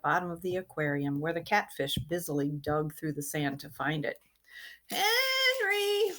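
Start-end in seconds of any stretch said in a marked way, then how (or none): 4.9-6.1 calling out. Acted. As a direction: call him from far off. Robert calls from the yard.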